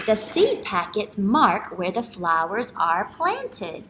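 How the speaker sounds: lively, slow storytime voice; reading to children. Acted. Teacher reading a picture book aloud to kids. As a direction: read each line out warmly for kids.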